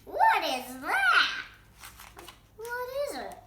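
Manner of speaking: startled high question; high surprised voice. Acted. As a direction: gasp out the question, amazed. Character reacts in surprise.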